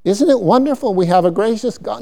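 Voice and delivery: ordinary delivery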